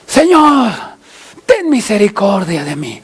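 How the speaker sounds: imploring, acted wail; wailing cry. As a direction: cry out to God with a falling wail. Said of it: "con un alarido".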